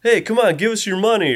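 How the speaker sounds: lightly voiced bully, pitched well up; mock bully. Retelling bullies messing with Max in the graveyard, mock bully. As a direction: imitate a pushy punk, high-pitched. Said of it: "when the two bullies are messing with Max".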